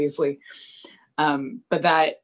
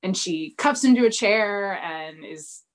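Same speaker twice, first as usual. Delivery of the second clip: ordinary delivery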